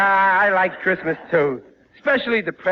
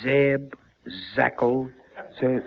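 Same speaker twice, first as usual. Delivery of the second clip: low, mumbled names; mumbling names; 8 semitones lower, 5 dB quieter, slower than usual. Acted. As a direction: mumble through the directory. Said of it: "what are you mumbling about".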